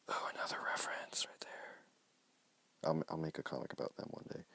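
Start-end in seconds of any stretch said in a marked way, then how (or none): 0.1-1.8 whisper. Real, not acted. Aside whispered.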